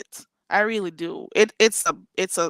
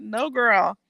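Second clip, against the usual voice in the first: ordinary talk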